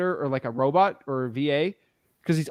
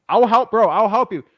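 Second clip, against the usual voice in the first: louder, insistent promise; emphatic; 4 semitones higher, 8 dB louder than usual. Real, not acted. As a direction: push the offer hard. Insisting to the caller.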